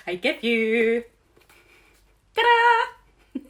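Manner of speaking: building to a loud, high ta-da; big drumroll build into a triumphant reveal; grand unveiling. Acted. Mock-grand unveiling of her plan.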